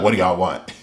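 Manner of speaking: low, grumpy retort; irritated Kawaki. Retold or imitated. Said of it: "aggravated and irritated".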